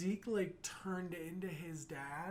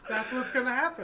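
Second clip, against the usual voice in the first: louder, higher repeat near tears; rising excitement; 5 semitones higher, 7 dB louder than usual. Real, not acted. Repeating the prediction to the co-host, excited and louder. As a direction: say it like the realization just hit. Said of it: "repeated, rising".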